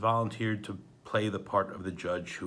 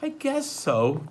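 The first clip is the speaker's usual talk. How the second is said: reluctant; 6 semitones higher, 5 dB louder, slower than usual. Acted. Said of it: "Violet said".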